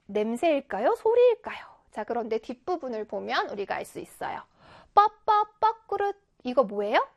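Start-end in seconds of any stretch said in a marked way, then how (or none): 4.9-6.2 high, louder chirping bird call; birdsong imitation. Shown as a demonstration. Teacher sounds out the bird in the exercise, birdsong imitation. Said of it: "새 지저귀는 소리죠".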